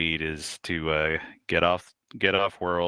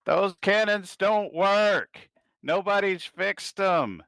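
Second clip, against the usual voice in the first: much higher, slow whiny son; 14 semitones higher, 4 dB louder, slower than usual. Acted. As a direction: whine it out, pitched up. Son objects to mother.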